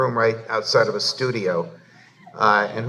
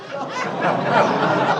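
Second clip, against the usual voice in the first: high burst of laughter; a sudden high laugh of amusement. Real, not acted. Laughing at the mix-up.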